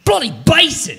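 high, hammed-up British accent; mock British accent. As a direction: put on a playful British accent. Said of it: "Nice accent".

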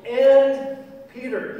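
AND high, loud and drawn out; stress on AND. Acted. Angel's message to the one who betrayed, stress on AND. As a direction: hit "and" hard before Peter's name. Repeats message, singling out Peter.

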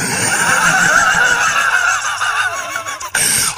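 big high cackle. Real, not acted. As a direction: burst out laughing. Laughs at own line.

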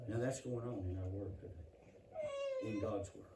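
quiet, tearful preaching; crying. Real, not acted. Preacher to his church, weeping over harvested babies. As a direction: let real tears break through, soft.

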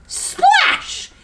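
very high-pitched splash; sudden splash. A narrator's reading. Narrator sounding a fall into the river, high. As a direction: burst it out high, like hitting water.

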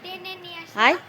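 loud high calls to the cats; calling the cats. Real, not acted. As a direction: call them over across the roof.

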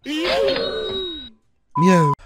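long high cat meow; high drawn-out meow. Pretend.

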